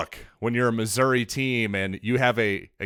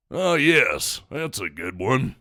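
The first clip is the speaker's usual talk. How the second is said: Sam Elliott; slower than usual. Acted. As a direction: do a laid-back cowboy-actor impression, easing down at the end. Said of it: "that's my Sam Elliott impression".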